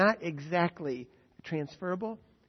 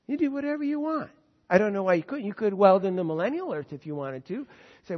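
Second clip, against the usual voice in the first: ordinary delivery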